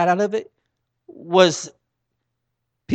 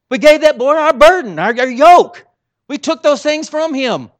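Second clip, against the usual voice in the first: booming preacher's cry, pitched up; preaching loud; 8 semitones higher, 9 dB louder than usual. Real, not acted. Preacher booming to the congregation about burdens. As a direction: drive it home at full force. Preacher building to full force.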